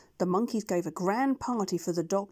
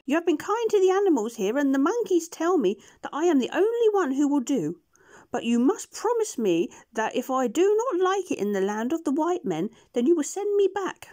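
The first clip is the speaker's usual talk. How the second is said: much higher, shy creature voice; gentle beast agreeing; 8 semitones higher, 6 dB louder than usual. Acted. Push-Me-Pull-You agrees to go with the doctor, high shy voice.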